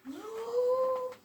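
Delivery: sharp high gasp; high dramatic gasp. Acted. Storyteller gasping at the change, high gasp. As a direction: gasp in wonder.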